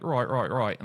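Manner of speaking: trying out an Australian twang; Australian warm-up word; Australian accent. Shown as a demonstration. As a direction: say it with broad Australian vowels. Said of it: "that was Australian".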